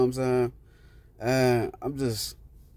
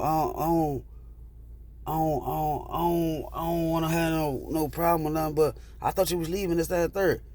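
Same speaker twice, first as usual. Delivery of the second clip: defensive excuse; 4 semitones higher, 3 dB quieter than usual. Retold or imitated. Other man backing off, making excuses.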